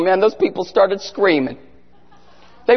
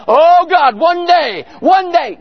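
mock fervent prayer; 5 semitones higher, slower than usual. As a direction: cry out like an imitated desperate prayer. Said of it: "in my room and just prayed".

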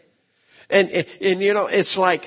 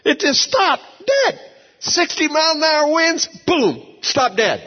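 leaps much higher, shouting dead; amazed; 11 semitones higher than usual. Real, not acted. Pastor telling his church the storm stopped, excited shout. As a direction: burst out in disbelief.

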